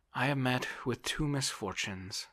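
a touch of woe; lightly voiced plaintive landowner. A narrator's reading. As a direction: lightly play a helpless man airing his troubles. Voices Oblomov complaining.

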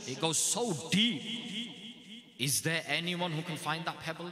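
high, loud, slow, fervent sermon delivery; preaching at full force. Acted. Imam urging the gathering to hide their good deeds: impassioned preaching. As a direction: drive each point home to the crowd.

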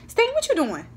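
high call across the house. Real, not acted. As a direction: call out to someone in another room. Calling out to someone downstairs.

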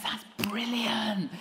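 awed whisper; whispered that's brilliant. Retold or imitated. Friend whispers reply.

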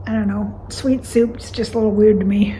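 talking into a mug; speaking between sips. Real, not acted. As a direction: lips at cup rim. Seen 1: Mug held at lips through most of labelled words.

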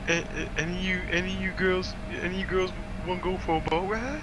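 high-pitched, stammering put-on voice; imitated stammer, rising at the end; speech impediment. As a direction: act a man struggling to get words out. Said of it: "He got a speech impediment".

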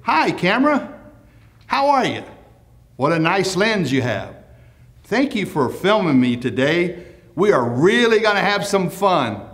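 high, bright friendly hello to the camera; cheery greeting. Shown as a demonstration. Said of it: "look directly into the lens and say".

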